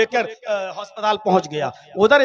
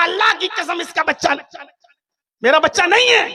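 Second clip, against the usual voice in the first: higher, shouted counter-oath; shouted denial; 8 semitones higher, 3 dB louder than usual. Acted. Driver shouting his denial back at the woman. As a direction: swear back just as hard.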